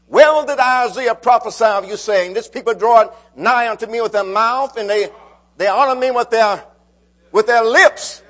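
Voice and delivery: loud, rising preacher's cadence; fervent loud preaching. Acted. Preacher rousing his congregation, loud fervent preaching. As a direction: preach it out to the back pews.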